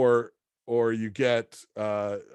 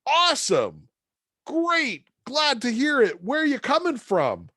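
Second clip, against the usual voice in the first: much higher, chipper salesman voice; cheery Joe; 13 semitones higher, 4 dB louder than usual. Retold or imitated. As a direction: sell Milwaukee like a booster. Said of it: "And he's like".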